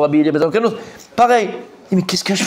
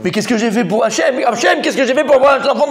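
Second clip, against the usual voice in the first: despairing parent; 7 semitones higher than usual. Retold or imitated. Parent wailing to Hachem over his son, hammed-up. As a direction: cry out to Hachem in mock despair.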